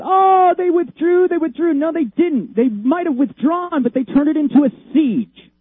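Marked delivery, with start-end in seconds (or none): 0.0-1.7 mocking quote. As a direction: mimic them with scorn. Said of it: "they always talk about".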